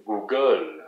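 "Google" with a long stressed "gle"; French pronunciation. Shown as a demonstration. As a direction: say "Google" the French way, weight at the end. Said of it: "So in French".

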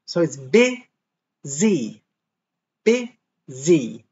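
"busy" slowly, with a buzzing Z in the middle. Shown as a demonstration. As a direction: stretch the Z in "busy". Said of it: "the S is pronounced like a Z".

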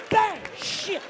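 high bang and curse. Acted. Gunshot and reaction.

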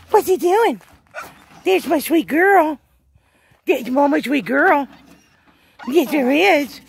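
high, loud, sing-song cooing; slow doting puppy talk. Real, not acted.

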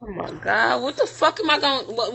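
ordinary talk

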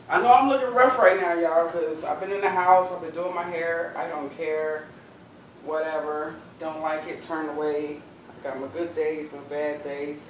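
ordinary delivery

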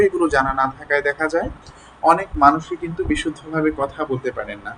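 ordinary talk

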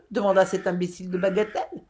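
ordinary delivery, nothing marked

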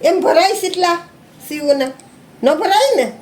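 high-pitched gentle chiding. Real, not acted. Mock scolding a little child.

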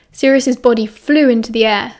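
ordinary delivery

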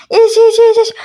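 urgent shouting. Acted. Urgent drinking plea.

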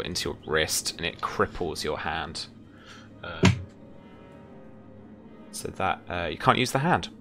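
ordinary delivery

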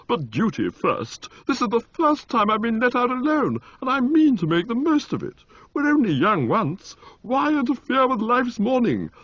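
put-on high, eager young lord; a gleeful toff let loose; Motty voice. A narrator's reading. Motty to Bertie on city temptations, high and eager.

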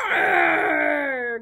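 loud playful roar; dinosaur roar. Shown as a demonstration. Teacher roars to show kids, loud. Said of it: "Let's hear you do it".

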